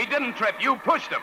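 high, hard accusation; angry accusation. Acted. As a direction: nail her with it. Heated accusation.

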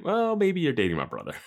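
high, hesitant sheepish suggestion. Acted. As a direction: act out an awkward guy dodging. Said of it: "sheepish in this".

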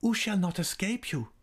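very high, anxious question; high-pitched woman challenging him; changed voice. A narrator's reading. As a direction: press the question in an altered voice. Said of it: "she asked in a changed voice".

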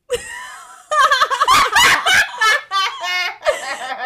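high, loud, sustained cackle; loud laughing fit. Real, not acted. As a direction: burst out in uncontrolled laughter. Cracks up at accent request.

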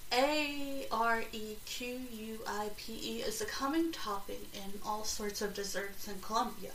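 normal talking voice

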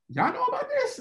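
a very high cracked "y'all know about this"; shocked squeak. Retold or imitated. To teammates who saw his video, a very high shocked squeak. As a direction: let the voice jump in disbelief.

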